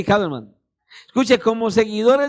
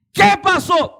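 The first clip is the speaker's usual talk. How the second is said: high, louder greeting; imitated friend; 9 semitones higher, 4 dB louder, slower than usual. Friend greeting the preacher at the door, high and casual. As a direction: toss out a bright casual hello.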